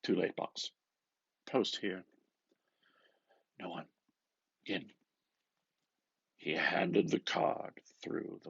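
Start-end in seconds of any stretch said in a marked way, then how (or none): none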